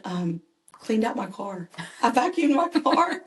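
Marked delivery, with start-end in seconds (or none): 2.0-3.2 laughing. Real, not acted. Laughing at own story.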